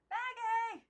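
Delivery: high, loud call from the garden. Acted. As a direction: call her name across the yard. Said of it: "Aunt Lillian's voice came from the garden".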